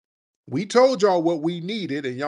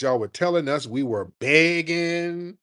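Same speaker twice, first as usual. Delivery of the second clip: usual speaking voice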